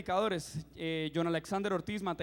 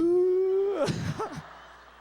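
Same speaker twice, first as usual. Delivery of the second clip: high, loud burst of laughter; 11 semitones higher, 7 dB louder than usual. Real, not acted. Preacher laughing at his own tears: loud, high laughter. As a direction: laugh hard at yourself.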